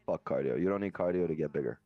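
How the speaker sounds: ordinary talk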